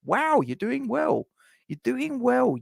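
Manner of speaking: high-pitched, impressed wow; impressed surprise. Real, not acted. Streamer reacting to a viewer's age, impressed surprise.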